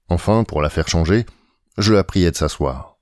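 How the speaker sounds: plain reading voice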